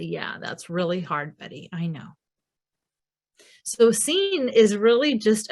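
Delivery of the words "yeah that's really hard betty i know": gentle, soft empathy. Retold or imitated. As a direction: let him know you get it, quietly. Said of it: "respond to him in a way that matches how he feels".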